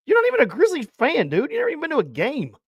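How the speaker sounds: high-pitched disbelieving protest; incredulous. Real, not acted. Host challenging co-host's claim to be a Grizzlies fan, incredulous.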